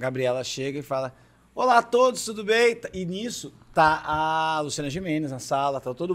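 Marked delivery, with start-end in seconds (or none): 1.5-2.7 higher, louder, bright TV host hello; cheery host greeting. Acted. Gabriela greeting the casting hopefuls, higher and louder. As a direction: greet the room like a chirpy presenter.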